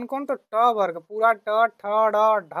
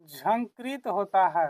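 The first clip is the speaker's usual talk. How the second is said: slower, softer repeat of the key term; slow teaching repeat; 5 dB quieter than usual. Shown as a demonstration. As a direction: spell out the answer slowly and softly. Answers own question, slowly for learners. (धीरे-धीरे, समझाते हुए दोहराना)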